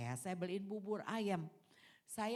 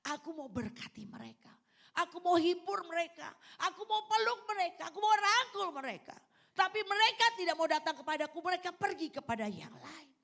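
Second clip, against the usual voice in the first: loud, high-pitched pleading as God; acted anguished appeal, voice raised high; impassioned divine lament; 9 semitones higher, 8 dB louder than usual. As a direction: cry it out in urgent, mounting repetition. Voicing God's anguished appeal.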